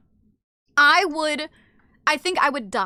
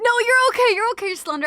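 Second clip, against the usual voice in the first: hasty reassurance; 9 semitones higher, 5 dB louder, faster than usual. Real, not acted. Hurrying to reassure a viewer.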